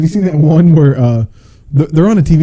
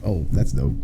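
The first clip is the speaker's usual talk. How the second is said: quiet aside; 9 semitones lower, 10 dB quieter than usual. Retold or imitated. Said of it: "I'm like".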